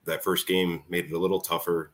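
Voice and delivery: normal talking voice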